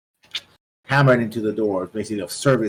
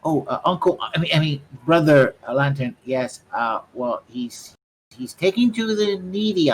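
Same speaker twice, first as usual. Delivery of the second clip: lightly voiced young rat, pitched up; teenage rat; 6 semitones higher, 4 dB louder than usual. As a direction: stumble through it as a youngster. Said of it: "He's like a teenager or something".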